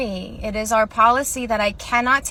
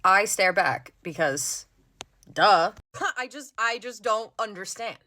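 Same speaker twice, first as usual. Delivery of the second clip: ordinary talk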